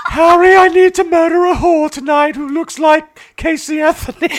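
loud, slow, very high royal parody; the Queen. Acted. The Queen telling Harry her murder plan, hammed-up high royal voice. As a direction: announce it in a hammed-up regal falsetto. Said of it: "I'm being the queen".

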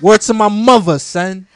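loud New York slang oath; New York accent. Pretend. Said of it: "On our New York thing today".

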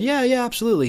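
high-pitched, agreeable quoted reply; eager agreement, acted. Couple answering their Bible school teacher, mock-eager.

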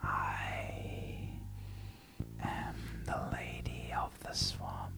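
slow spectral whisper, long pauses; whispering ghost. Acted. Lady of the Swamp reveals herself to Lilith, eerie whisper. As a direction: whisper it like an apparition.

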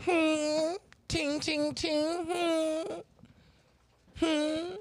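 high, ringing mimicked dings; imitated sound effect. Friend shows off his new Jamaican drip, imitated sound effect.

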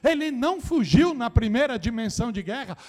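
shouted exhortation to the congregation; preaching at full force. Acted. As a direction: drive the message home like a fiery preacher.

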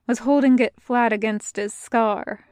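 usual speaking voice

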